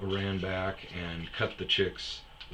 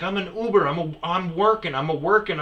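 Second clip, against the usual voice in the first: higher, louder mock protest; whiny driver; 8 semitones higher, 7 dB louder than usual. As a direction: repeat it like a weak excuse.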